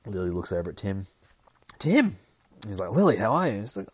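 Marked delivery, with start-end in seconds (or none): 1.8-2.2 high, loud call of his name; acted-out delighted recognition; surprised greeting. Lily spotting Tim at the bar, surprised greeting. 2.9-3.6 eager greeting. Retold or imitated. Tim greets Lily back.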